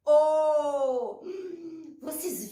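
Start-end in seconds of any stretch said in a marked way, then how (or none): 0.1-1.2 O held long and loud; letter sound demo. Shown as a demonstration. Teacher repeating the sound of O, sounded out. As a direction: sustain a steady O. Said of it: "De novo, ó".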